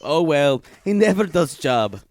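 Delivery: broken-English Olga, deadpan and boastful; Olga character. Acted. A made-up Olga telling the hosts about her trip, deadpan and broken. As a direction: play Olga as a blunt foreign traveler.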